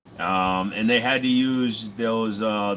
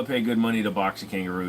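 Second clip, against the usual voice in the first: normal talking voice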